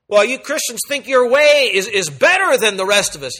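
mildly sneering skeptic; mocking objector.

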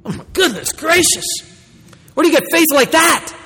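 amazed exclamation. Real, not acted. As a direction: exclaim in wonder at such faith. Preacher marvels at Christ's faith.

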